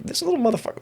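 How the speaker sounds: dad's remark. Retold or imitated. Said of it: "Dad was like".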